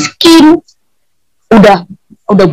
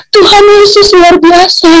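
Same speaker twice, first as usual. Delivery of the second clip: much higher, tearful exclamation; tearful cry; 7 semitones higher than usual. Retold or imitated. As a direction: exclaim it through tears. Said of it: "Makanya tadi dia teriak".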